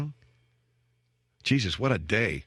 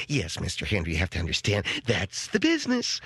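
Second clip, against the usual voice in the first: polite saleswoman; 4 semitones lower than usual. Retold or imitated. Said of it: "everybody is so polite".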